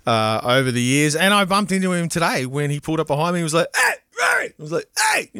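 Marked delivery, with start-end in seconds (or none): 3.8-4.5 high-pitched excited mock holler; retold shout. Friend calls from the car behind, retold high shout. 5.0-5.3 very high mock yell back; retold shout. Host answers the friend behind him, retold high shout.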